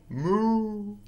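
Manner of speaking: high, funny funnelled moo; moo through a funnel. Acted. Reader acts a cow with a funnel, funny high moo. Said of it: "funny through a funnel".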